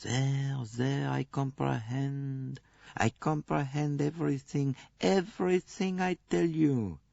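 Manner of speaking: Poirot gently consoling the nurse; gentle comforting. A narrator's reading. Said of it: "continued to pat her gently".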